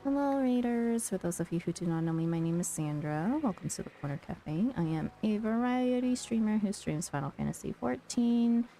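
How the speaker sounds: ordinary delivery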